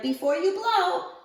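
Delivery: high, eager call; bright high shout of a wish; children crying out. Acted. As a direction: sing it out like excited kids. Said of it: "the children cried".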